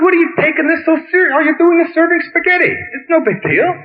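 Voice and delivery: very high, fast mock pleading; exasperated challenge. Reasoning with an upset waiter, high exasperated voice. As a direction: push it high and quick in disbelief.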